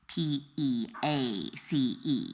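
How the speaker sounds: "P E A C E" spelled letter by letter, slow and soft. Shown as a demonstration. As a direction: spell out "P E A C E" slowly, one letter at a time.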